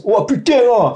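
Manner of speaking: hammed-up Marseille accent, imitating the girlfriend; playful Marseille accent from the south of France. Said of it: "elle fait toujours l'accent marseillais".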